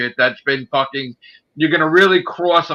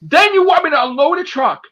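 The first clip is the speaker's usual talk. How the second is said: much higher, loud, near-crying shout; furious rant; 12 semitones higher, 4 dB louder than usual. Real, not acted. Raging at the investigator who called him a thief, shouting. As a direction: yell it out, voice cracking with outrage.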